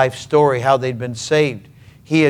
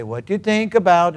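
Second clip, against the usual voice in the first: put-on Norwegian accent, pitched higher; 5 semitones higher than usual. Grandfather draws out his grandson's thoughts, Norwegian accent. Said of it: "Norwegian accent, he'd say".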